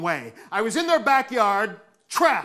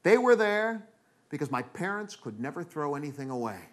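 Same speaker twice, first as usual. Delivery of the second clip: plain reading voice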